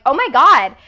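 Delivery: a stranger caught off guard in the stall; startled exclamation. Retold or imitated. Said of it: "she was like".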